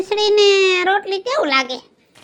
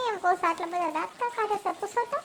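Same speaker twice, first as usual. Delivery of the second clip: hushed aside; 3 semitones lower, 14 dB quieter than usual. Acted. Aside to the other host about the grinning uncle, hushed. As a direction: drop to a hush, voice far down.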